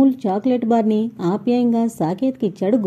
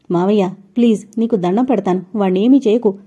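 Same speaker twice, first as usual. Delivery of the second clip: usual speaking voice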